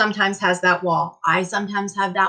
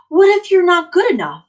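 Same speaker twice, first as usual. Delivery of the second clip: high, loud anxious question; worried question; 8 semitones higher, 7 dB louder than usual. Acted. Teacher voicing a child's doubt, high and anxious.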